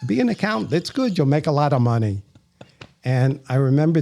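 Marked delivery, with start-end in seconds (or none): 0.0-2.2 put-on lilting Yiddish accent, pitched up.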